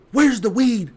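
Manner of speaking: loud, high imitation of an angry dad; angry demand. Dad confronts his high son, angry demand. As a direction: demand it loudly as the furious father.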